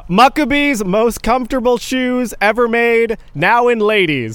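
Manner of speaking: loud, slow, all-caps ad read; shouting capitalized words. Shown as a demonstration. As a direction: boom it out like a shouted headline. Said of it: "I would like you to read it in all caps as well".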